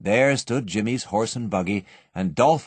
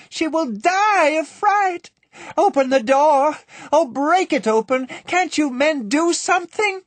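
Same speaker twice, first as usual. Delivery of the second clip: wailing mother; 5 dB louder than usual. A narrator's reading. Mother wailing for the child in the vault. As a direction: wail in terror for her child. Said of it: "wailed the mother".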